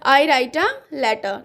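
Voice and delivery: very slow, each word stretched; slow dictation. Shown as a demonstration. Teacher repeating the answer for students, slowly. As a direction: read it out slowly for writing. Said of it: "okay".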